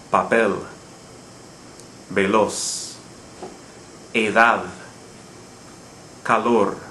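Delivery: paPEL, veLOZ, eDAD, caLOR; final-syllable stress. Shown as a demonstration. Teacher giving words that follow the rule, Papel to calor each stressed on the last syllable. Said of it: "would naturally fall on the final syllable".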